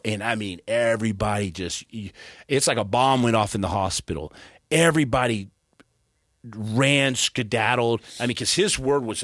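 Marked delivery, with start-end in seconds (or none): none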